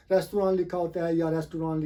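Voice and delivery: "रेस्तुरां" and "रेस्टोरेंट" both as res-tu-raan; three syllables ending in long aan in "रेस्तुरां"; wrong pronunciation. Shown as a demonstration. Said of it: "ना तो ये रेस्तुरां है".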